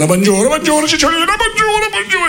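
bonjours qui montent de plus en plus aigu; curé troublé. Acted. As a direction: perdre ses moyens, la voix grimpe. Curé troublé par la Cicciolina. (curé affolé, voix qui monte dans l'aigu)

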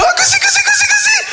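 squealing high mock giggle; father's giggling. Father being tickled, shrieking high. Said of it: "il fait".

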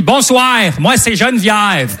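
drawn-out, thick Québécois vowels; mock Québécois accent. Beautiful woman introducing herself at a bar, mock Québécois accent. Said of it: "très bizarre à ce moment-là, l'accent québécois".

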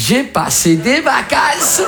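very drawn-out, high mock delivery; hammed-up returning traveller; imitated holidaymaker. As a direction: stretch every word, rising in pitch. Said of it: "qui m'a dit".

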